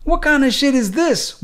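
high, stunned out-loud wondering; can't believe what he's seeing; baffled outburst. Acted. Donald stunned at the checked box, high and baffled. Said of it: "wondered Donald out loud".

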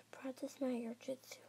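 hushed unvoiced whisper. Character whispering plans to himself. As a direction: whisper it so nobody hears.

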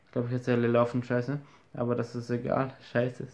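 ordinary talk

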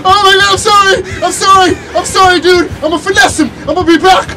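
high loud frantic shouting; panicked yelling. Real, not acted. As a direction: yell while running for your life. Fleeing the killer, apologizing.

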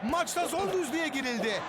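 normal talking voice

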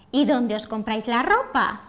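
"¿Y dónde os compráis la ropa?" kept level, then a rise on the stressed "ro" of "ropa"; amazed question with the jump on the last stress; surprised question. Shown as a demonstration. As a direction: ask it in disbelief, with the pitch jumping up on "ropa". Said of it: "Esa entonación marca que estás sorprendido".

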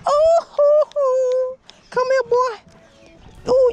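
very high, loud falsetto whoops; excited whooping. Real, not acted. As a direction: whoop in a drawn-out high squeal over the catch. Angler hooks a fish.

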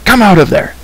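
high, forceful command; ordering. A narrator's reading. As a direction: order them out, pitched right up. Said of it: "Taran ordered".

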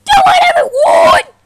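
high, loud shout to the crowd; rallying shout. Acted. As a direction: whip up the crowd.

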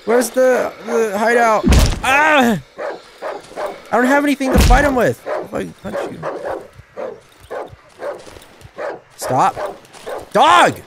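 loud high frantic yelling; real panic while running; panicked shouting. Real, not acted. Player screaming while fleeing the dogs.

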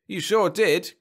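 loud, high, triumphant crow. A narrator's reading. Husband boasting to his wife, crowing. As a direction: crow it proudly. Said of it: "crowed Jeb".

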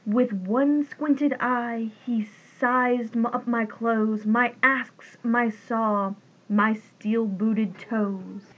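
ordinary delivery

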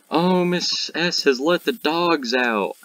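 high, drawn-out mock student voice; imitated teasing classmate. Imitating classmates teasing their teacher.

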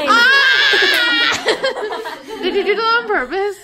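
shrieking with laughter; laughing shout. Real, not acted. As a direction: burst out laughing, high and loud. Friend says sixty-nine as a joke.

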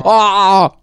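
loud, high cry of alarm; a hammed-up alarmed gasp at the alien; startled shout. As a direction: gasp out the discovery. Playing love child meeting alien.

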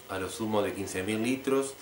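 usual speaking voice

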